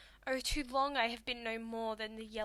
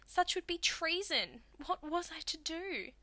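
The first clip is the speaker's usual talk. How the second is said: higher, dramatic appeal; theatrical dismay, pitched up; helpless protest; 5 semitones higher than usual. Acted. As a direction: plead that there was no choice. Cornered daughter protests her helplessness.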